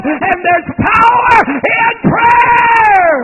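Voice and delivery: loud, high sermon climbing to a shouted peak; full-force preaching. Real, not acted. Preacher telling the church there's power in prayer, full-force shouted preaching. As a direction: preach it at full voice, stretching the last words.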